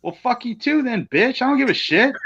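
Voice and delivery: high, mock-offended snipe; huffy retort. Wrestler retelling snapping back at his partner, high mock huff. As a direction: toss it off like a sulking teammate. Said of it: "And I'm like".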